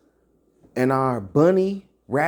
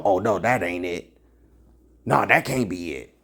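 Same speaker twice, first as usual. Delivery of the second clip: lower, scoffing dismissal; mock dismissive denial; 7 semitones lower than usual. Preacher imitating listeners rejecting his answer, scoffing. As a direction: brush the answer off. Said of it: "you just know".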